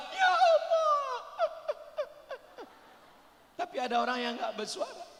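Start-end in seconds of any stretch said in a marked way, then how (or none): 0.1-1.1 high, slow shouted plea; loud cry to God. Retold or imitated. Preacher shows someone praying aloud, a high shout. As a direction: call out to God at full voice. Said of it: "istighfar dengan suara".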